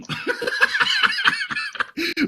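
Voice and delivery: squealing burst of laughter; high shrieking laugh. Real, not acted. Reader cracking up at rule table, squealing laugh.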